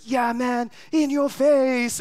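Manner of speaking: high, slow, gloating jeer; hammed-up rival fan taunting the crowd; mock taunt. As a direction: stretch it out to rub it in. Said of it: "that's not how he sounded".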